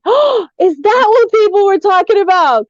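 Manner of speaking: high-pitched burst of sudden realisation; retold surprise. Retelling when the viral story clicked for her, surprised realisation.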